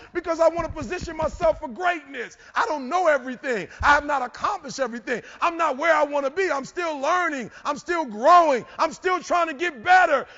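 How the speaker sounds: high, loud, emphatic motivational climax; full-force preaching. Real, not acted. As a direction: shout each declaration out to the crowd.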